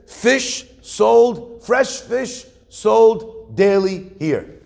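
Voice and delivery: each word drawn out, high and loud; slow sign reading. Retold or imitated. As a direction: spell the sign out slowly for him. Learned man reads sign aloud.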